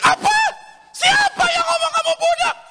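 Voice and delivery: very high, loud mock motherly shriek; enraged shout. Manado mother erupts on hearing child was teased, enraged shout. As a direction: explode at full volume in outrage. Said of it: "langsung dia teriak".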